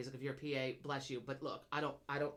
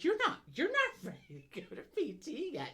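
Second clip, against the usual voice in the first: imitated Joe; 11 semitones higher than usual. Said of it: "He's like".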